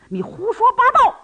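outraged shout in a quarrel; angry shout. A narrator's reading. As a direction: shout down the insult. Quarrel with the old beggar.

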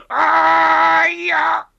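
high, loud outraged cry; enraged roar. Acted. Gao Feng roaring after being publicly insulted, furious. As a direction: bellow in fury.

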